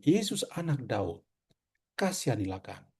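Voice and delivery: soft, low plea fading on kasihanilah; patient pleading. Retold or imitated. Preacher voices the patient blind man's quiet plea. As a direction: murmur the request, humbly. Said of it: "dia memohon".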